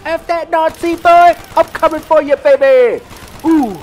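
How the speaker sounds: very loud, high gleeful yells; ecstatic shouting. Real, not acted. Gamer screaming taunts at enemy soldiers, very loud and high. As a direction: scream your delight at the enemy. Ecstatic after big multikill.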